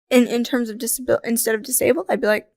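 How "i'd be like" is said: ordinary talk, nothing marked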